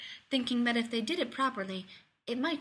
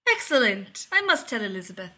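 delighted; 7 dB louder than usual. A narrator's reading. Roddick delighted by the news.

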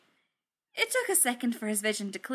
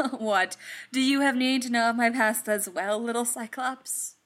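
amused, laugh-led teasing; 3 semitones higher, 5 dB louder than usual. A narrator's reading. Thor teasing the little bot, laughing. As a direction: start with a laugh and tease, voice lifted. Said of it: "Thor laughed".

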